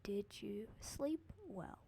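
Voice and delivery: slow eerie whisper, breath only; creepy whispered repeat. Retold or imitated. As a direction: breathe it out slowly like a possessed mom. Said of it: "She repeated it again".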